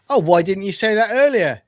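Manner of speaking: hammed-up captain caving in; mock enemy captain. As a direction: play the enemy suddenly won over. Voicing the attacking enemy captain.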